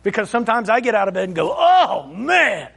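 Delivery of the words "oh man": mock morning groan.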